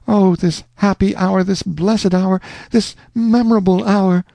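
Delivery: high, rapturous heroine; cling to him with joy; Rosanna, rapturous. A narrator's reading. Rosanna begging Alonzo to stay, rapturous.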